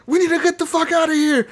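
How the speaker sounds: imitating a freaked-out crewmate; panicked shout. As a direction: yell it in a total panic. Crewmate freaking out, fleeing island.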